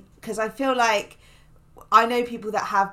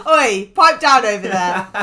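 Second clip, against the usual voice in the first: parent telling off; 11 dB louder than usual. Retold or imitated. Parent voiced at dinner table.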